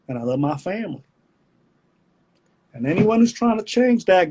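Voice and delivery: slow, lightly Southern patriotic speech, each line spaced out; imitating a proud Southern country boy, slow and deliberate; a hint of a Southern accent in a patriotic speech; Southern drawl speech. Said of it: "the most Southern speech I ever heard, where the guy goes".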